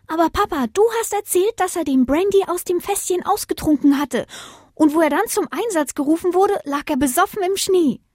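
put-on very high child-like voice; high-pitched, insistent little one; little puppy tattling. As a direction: argue back to Papa like a tiny pup. Pup tells on his uncle.

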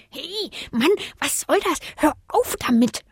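high, indignant creature voice; high-pitched telling-off; scolding bush. A narrator's reading. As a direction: grumble crossly at the intruder. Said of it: "schimpfte der Strauch".

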